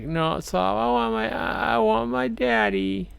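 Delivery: high, slow wail for daddy; crying little boy. Retold or imitated. Little boy cries for his dead father, high and slow. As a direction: whimper it like a sobbing kid. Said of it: "He's like".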